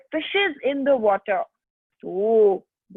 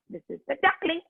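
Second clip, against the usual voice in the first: put-on higher pitch for the baby duck; high little duckling; 7 semitones higher, much faster than usual. Shown as a demonstration. Teacher showing children the baby duck, raised voice. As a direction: lift "duckling" up high and small.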